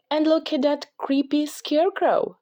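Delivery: put-on high, excited girl voice pointing things out; little girl voice, curious and playful; bright little girl Lucy. A narrator's reading. Lucy voiced in read story.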